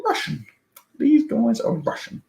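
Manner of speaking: high, slow dawning realization; knowing, drawn-out aha; mock realization. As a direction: act out catching on. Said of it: "I was like".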